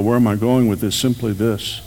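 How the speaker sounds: ordinary talk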